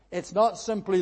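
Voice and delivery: normal talking voice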